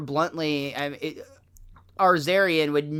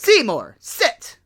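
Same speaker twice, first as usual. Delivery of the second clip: commanding mother; 7 semitones higher, 5 dB louder, slower than usual. Retold or imitated. Agnes commanding.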